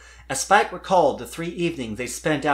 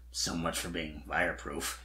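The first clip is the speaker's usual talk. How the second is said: low, much softer grumble under the breath; grumbled; 8 semitones lower, 10 dB quieter than usual. Acted. Spike grumbling to himself about being fireproof, low and soft. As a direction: grumble it to yourself. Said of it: "he grumbled internally".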